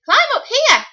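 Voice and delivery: high, loud call from the treetops; monkey calling. Acted. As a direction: shout it down from up high. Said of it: "called the monkey from the treetops".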